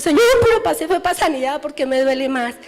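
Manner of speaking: protesting to God about her pain; pleading complaint. Retold or imitated. (queja aguda y más fuerte al Señor)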